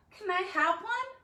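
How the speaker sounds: put-on high, hopeful small-kid ask; eager little brother. Little brother asking for candy.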